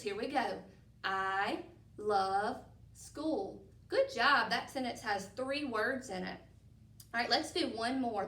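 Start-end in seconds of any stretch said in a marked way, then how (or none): 1.0-3.6 very slow I love school, each word apart. Shown as a demonstration. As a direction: count each word slowly. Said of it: "let's count our words".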